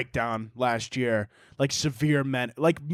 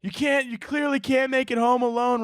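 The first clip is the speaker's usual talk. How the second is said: higher, worried mom voice; lightly voiced worried mom calling her son; mom freaking out; 9 semitones higher than usual. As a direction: mimic a panicked mother. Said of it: "She's like".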